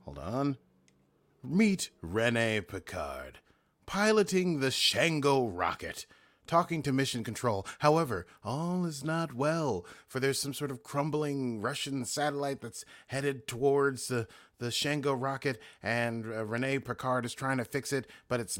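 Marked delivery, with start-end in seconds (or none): 1.4-12.6 slow, weighty trailer-voice narration; dramatic narrator. Acted. Host restarts the recap intro for listeners, slow dramatic narration. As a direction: build the scene like a movie trailer.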